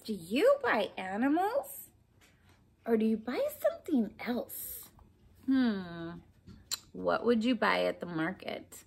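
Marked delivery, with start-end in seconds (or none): none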